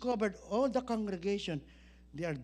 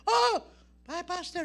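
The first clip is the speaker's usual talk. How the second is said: high, loud "Oh" then a lighter hi; mock surprise at meeting; surprised hello; 7 semitones higher, 6 dB louder than usual. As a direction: act startled, then friendly. Pastor surprised to see him.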